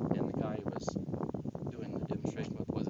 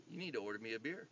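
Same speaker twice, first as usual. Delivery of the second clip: quiet menace; 4 semitones lower, 9 dB quieter, faster than usual. Retold or imitated. Dad voiced calmly commanding beaten man.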